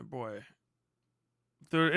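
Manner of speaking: ordinary talk, nothing marked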